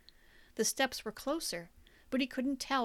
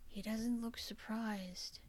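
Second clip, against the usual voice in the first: whispered, slow and unvoiced; hushed whisper; 7 dB quieter, slower than usual. A narrator's reading. Hiding Gordon whispers to himself, hushed. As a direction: breathe it so nobody hears.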